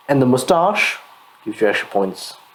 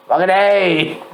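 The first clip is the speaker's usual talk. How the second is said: mock Aussie accent; 4 semitones higher, slower than usual. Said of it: "I don't know what accent that was".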